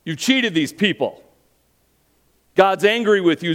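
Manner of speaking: loud, stern condemning call; angry crowd. Acted. Preacher voices the crowd condemning Zacchaeus, loud and stern. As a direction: demand he come down and pay. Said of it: "The crowd wants to hear Jesus say".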